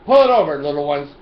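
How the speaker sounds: playful pull-over command; mock cop. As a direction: bellow like a traffic cop on a loudspeaker. Playing police siren loudspeaker.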